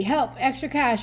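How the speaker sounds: ordinary delivery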